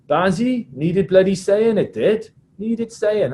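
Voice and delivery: high, loud mimicked Welsh prop; Adam Jones. Welsh prop justifying his speech to the referee, high insistent impression. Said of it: "British Irish Lions and Welsh prop".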